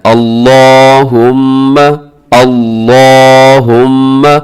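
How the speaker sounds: अल्लाहुम्मा with thick "law" and held "mm"; slow अल्लाहुम्मा, full rounded L; heavy L. Shown as a demonstration. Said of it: "इसको मोटा पढ़ना".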